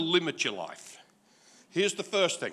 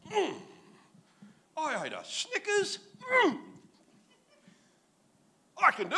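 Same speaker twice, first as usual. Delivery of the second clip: put-on boastful young David; 11 semitones higher than usual. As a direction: play David as a puffed-up kid, much higher.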